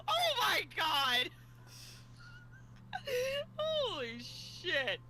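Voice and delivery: slow, wheezing laughter through words; laughing hard. Real, not acted. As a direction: crack up completely mid-sentence.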